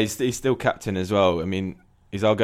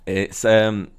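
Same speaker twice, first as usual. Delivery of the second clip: ordinary talk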